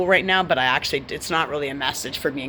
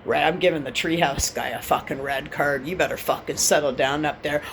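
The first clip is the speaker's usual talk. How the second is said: normal talking voice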